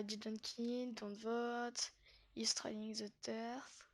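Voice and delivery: real French accent on English words. Real, not acted. Vlogger reading game phrases aloud, halting French-accented English. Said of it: "Mon magnifique accent anglais".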